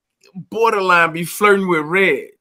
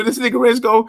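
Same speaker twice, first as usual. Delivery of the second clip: normal talking voice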